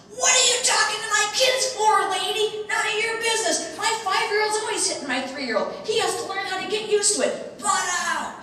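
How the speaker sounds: high, loud, hammed-up tirade; mad mother. Mother to a stranger correcting her kids, high furious yelling. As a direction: yell it shrilly like an outraged parent. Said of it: "She was just really mad".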